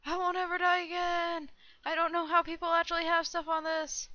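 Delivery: playfully grand and high; mock-triumphant boast. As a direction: keep gloating in a high pitch. Keeps up invincible boast.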